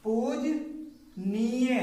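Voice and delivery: पूजनीय stretched out very slowly, syllable by syllable; drawn-out spelling. Shown as a demonstration. Teacher spelling a word to class, very slow and drawn out. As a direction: drag out पूजनीय so students can write it. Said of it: "spelling it out slowly".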